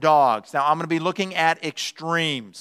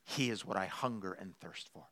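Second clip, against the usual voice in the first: low, near-whispered reverence; hushed, yearning dog; devoted dog; 9 semitones lower, 18 dB quieter than usual. Acted. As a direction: drop to a very soft, worshipful murmur. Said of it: "And a dog says".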